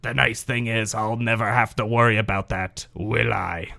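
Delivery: a cartoon villain, low and smug; lightly voiced smug rival fan, dropping to soft; gravelly cartoon character. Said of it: "sounded like a gravelly cartoon character".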